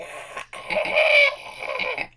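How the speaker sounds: squealing high laugh; helpless squeaky laughter; high wheezing laugh. Real, not acted. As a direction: crack up in a high-pitched squeal. Cracking up at own story.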